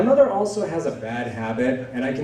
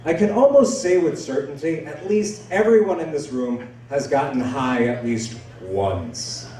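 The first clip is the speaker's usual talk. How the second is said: ordinary talk, nothing marked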